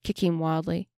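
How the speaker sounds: ordinary delivery, nothing marked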